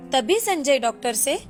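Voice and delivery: usual speaking voice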